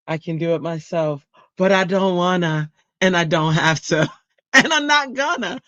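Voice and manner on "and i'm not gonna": high, giggling refusal; laughing. Real, not acted. Telling chat she won't do it herself, giggling. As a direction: giggle it out defiantly.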